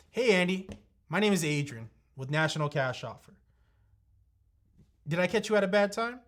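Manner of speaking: energetic cold-call opener; high energy. Shown as a demonstration. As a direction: open the call with bright friendly energy. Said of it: "That type of energy".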